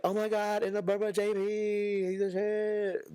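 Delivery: high mock gushing onlooker; imitating fans. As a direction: gush like a stunned stranger, drawing out the end. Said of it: "Motherfuckers be like".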